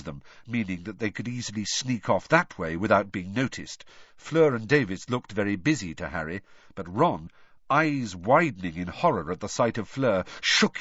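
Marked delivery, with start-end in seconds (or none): none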